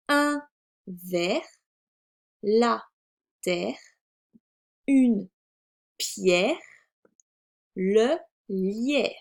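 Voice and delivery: isolated words, each article set apart; reading words aloud. Shown as a demonstration. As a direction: model each word slowly for beginners. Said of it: "nous allons lire les mots". (lecture de mots, lente et détachée)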